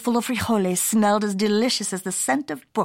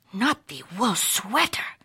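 hushed dread; 4 dB quieter, slower than usual. Acted. Skipito dreading the bully.

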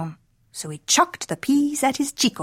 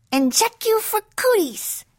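very high, teasing little voice; playful goddesses; 13 semitones higher than usual. A narrator's reading. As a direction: tease him in a squeaky high pitch. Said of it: "they said".

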